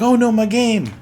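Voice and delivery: high, loud mock dismay; dismayed gamer. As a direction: whine like you lost your game. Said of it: "people tend to be like".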